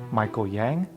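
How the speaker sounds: normal talking voice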